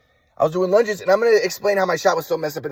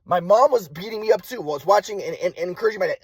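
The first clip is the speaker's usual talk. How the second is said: usual speaking voice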